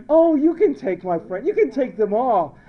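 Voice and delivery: high, loud smitten voice; hammed-up infatuated giving-in; lovestruck gushing. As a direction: gush like someone helplessly in love. Acts himself smitten with Jackie.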